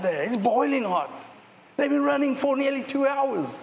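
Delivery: hammed-up sideline critic; armchair fan yelling. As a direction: heckle the players from the couch. Mimicking fans yelling at players.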